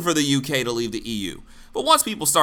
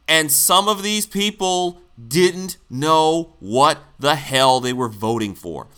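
louder, word-by-word "didn't know what"; slow emphatic; 4 dB louder, slower than usual. Real, not acted. Host driving home voters' ignorance, "didn't know what" spaced out. Repeats point slowly for emphasis.

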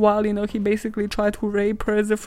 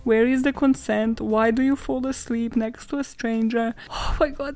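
ordinary talk

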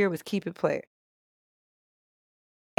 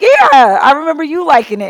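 mimicking him; 7 semitones higher, 7 dB louder than usual. Mimics him, high and loud.

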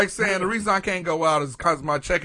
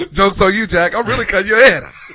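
gleeful killer taunt; 2 semitones higher, 9 dB louder than usual. Pretend. Voicing the killer woman, laughing.

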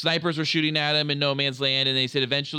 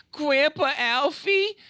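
put-on high, slow little-boy plea; 12 semitones higher, slower than usual. Little Sam begging his grandpa for a story, high and slow. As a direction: play the small grandson asking sweetly.

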